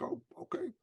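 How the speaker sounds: high, unvoiced deflated whisper. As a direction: breathe it out, crestfallen. Retold deflated reaction.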